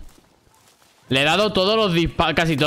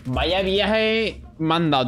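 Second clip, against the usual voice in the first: ordinary delivery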